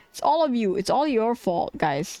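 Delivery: ordinary talk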